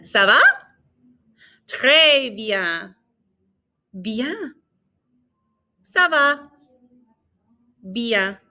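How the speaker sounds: whole slide modelled phrase by phrase; slide review. Shown as a demonstration. Teacher reviews the slide for students, clear French. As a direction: run through each phrase for repetition. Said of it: "Let's do the whole slide again".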